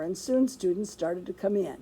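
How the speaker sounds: usual speaking voice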